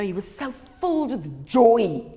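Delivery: ordinary delivery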